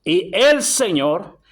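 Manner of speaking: "el" drawn out and stressed, slowly; slow emphatic reading, weight on "el Señor"; énfasis en El. Shown as a demonstration. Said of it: "dígalo más recio". («el Señor» lento y recalcado)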